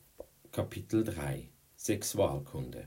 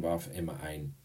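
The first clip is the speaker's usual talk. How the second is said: ordinary delivery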